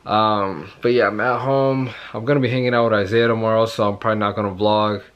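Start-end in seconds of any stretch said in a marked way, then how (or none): none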